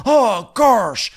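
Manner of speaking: mocking Shep refusing to change, high; stubborn Shep. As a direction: refuse flatly. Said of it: "Shep's like".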